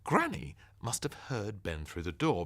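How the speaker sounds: plain reading voice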